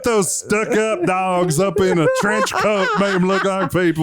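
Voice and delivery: scheming artist voice. Retold or imitated. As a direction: pitch it like a sly hustler.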